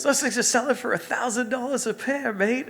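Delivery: high, loud Australian impression; Australian accent. Melbourne friend reporting resale prices, excited Australian accent. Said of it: "that's pretty good with the accent".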